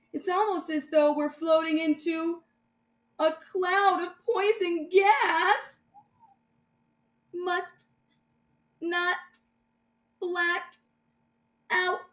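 slow, high, woozy; woozy, fading. Acted. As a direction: struggle to stay awake. Poison gas, fading.

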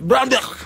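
"Brandberg" high, loud and hacked out like clearing the throat; exaggerated guttural "Brandberg". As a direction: cough up "Brandberg" with a harsh guttural ending. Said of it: "Sorry, I have to clear my throat".

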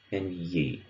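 "yi" set off as a sound; teacher modelling the ending "yi"; final yi demo. Shown as a demonstration. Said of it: "the end of words in Blackfoot".

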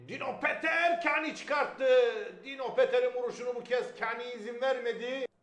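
ordinary talk

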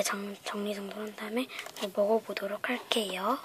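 whispering. Real, not acted. Whispered before tasting.

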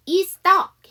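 "Is stuck" run together with only one S; linked, single S. Shown as a demonstration. Teacher shows learners the linking, one S in "Is stuck". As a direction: link "Is stuck", dropping the doubled S. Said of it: "S 안 할 거야".

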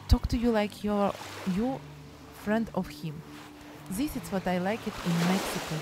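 usual speaking voice

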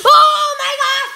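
very high, loud, drawn-out scream of surprise; shocked shout. Real, not acted. Vlogger reacts to the flying helicopter, screaming in shock. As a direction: shriek it out in real shock. Toy takes off wildly.

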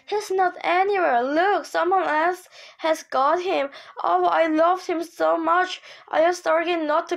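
high, loud and upset, close to tears; a girl convinced someone else has taken her dog, close to tears; distressed. A narrator's reading. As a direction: near tears, pleading that it isn't fair.

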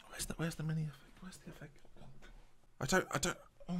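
hushed muttering while looking for the sound effect; whispered aside. Real, not acted. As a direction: whisper under the breath while searching. Searching for the effect mid-stream.

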